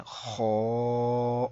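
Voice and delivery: خو with raspy kh and held heavy o; full, thick letter. Shown as a demonstration. Teacher illustrates the thick voice, drawn-out heavy خو. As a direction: round and thicken خو, holding the vowel. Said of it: "موٹی آواز میں پڑھا ہے".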